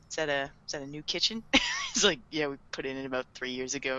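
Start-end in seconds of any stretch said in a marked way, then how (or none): 0.1-1.4 low, soft, quick mumbled question; awkward small talk. Retold or imitated. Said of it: "just awkward little them". 2.3-4.0 low, soft, quick reply; awkward small talk. Retold or imitated. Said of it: "just awkward little them".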